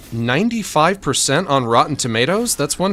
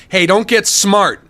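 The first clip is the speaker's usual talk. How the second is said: louder scolding; 5 semitones higher, 6 dB louder than usual. Acted. Streamer scolding chat, repeated.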